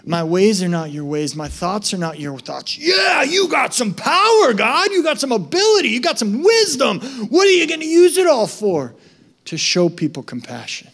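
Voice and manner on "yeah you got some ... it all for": high, loud, hammed-up voice of a man daring God; mock challenge to God. A man challenging God, loud and high mocking. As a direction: shout it up at God, demanding.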